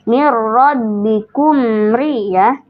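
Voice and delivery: "Mir rabbikum ri" slow, with a long held "mir"; slow tajwid demo. Shown as a demonstration. Teacher drilling the student on the verse, slowly. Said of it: "tingkatan gunanya yang ketiga".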